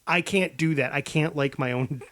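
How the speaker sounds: ordinary delivery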